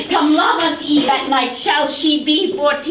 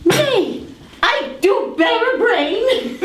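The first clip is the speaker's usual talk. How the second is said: ordinary talk, nothing marked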